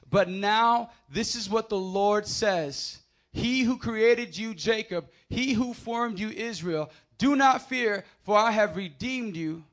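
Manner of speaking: slow measured reading; scripture reading. Acted. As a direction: read the verse steadily and calmly. First reading of verse.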